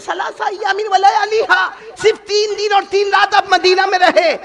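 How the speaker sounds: high, loud, amazed exclaiming; astonished outburst. Acted. Imam Malik astonished his teacher would leave, shouting. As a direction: cry out in disbelief at his teacher. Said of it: "امام مالک بڑے تعجب میں".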